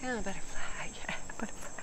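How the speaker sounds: lightly voiced cartoon wonder at a butterfly, fading into a laugh; a hint of a goofy sponge, dreamy and fading; SpongeBob. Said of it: "I'm SpongeBob again".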